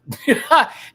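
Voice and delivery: high, loud burst of laughter. Real, not acted. Reviewer cracking up mid-review, loud high laugh. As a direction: let out a sudden loud cackle.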